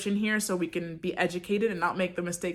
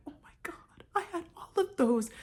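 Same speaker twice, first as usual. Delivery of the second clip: whispered realization; 9 semitones higher, 5 dB quieter than usual. Retold or imitated. Realizing she had heat stroke.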